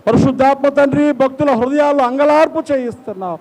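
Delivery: high-pitched, impassioned prayer cry; fervent preaching. Real, not acted. Preacher pleads to the interceding God, fervent preaching.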